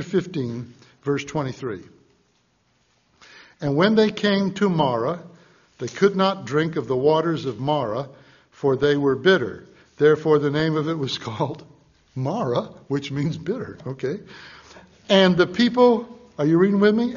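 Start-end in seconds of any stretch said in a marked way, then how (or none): 3.6-12.7 loud, measured Bible reading from the pulpit; scripture reading. Acted. Preacher reads scripture aloud. 15.1-16.1 loud, measured Bible reading from the pulpit; scripture reading. Acted. Preacher reads scripture aloud.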